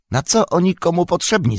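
high-pitched squabbling orc question; puzzled orcs. A narrator's reading. Several orcs asking why the prisoners are needed, in a high squeal. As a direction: squeal it out in disbelief. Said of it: "zapytało kilku naraz".

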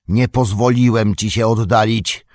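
severe, firm reproach; a stern wizard holding him there. A narrator's reading. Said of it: "surowo rzekł Gandalf". (surowo, stanowczo)

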